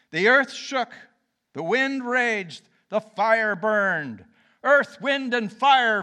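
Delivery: preacher booming the storm slowly; dramatic boom. Acted. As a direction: thunder out each image. Dramatic retelling of storm, quake, fire.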